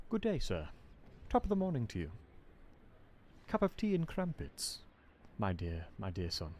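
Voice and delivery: a light mock posh English accent, soft and fading. Shown as a demonstration. English streamer showing chat a posh accent, mild mock posh English. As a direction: toff it up gently, tea and crumpets English. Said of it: "I can go very English".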